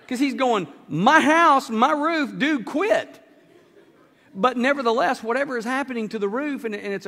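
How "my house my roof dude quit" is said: loud, higher, exasperated yelling; homeowner's protest. Retold or imitated. Homeowner yelling at the diggers to stop. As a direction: bark it like your roof is being wrecked.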